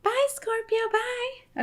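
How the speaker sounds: tiny high, soft voice; playful pet-voice goodbye. Pretend. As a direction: squeak a cute little farewell. Voicing the dog's goodbye.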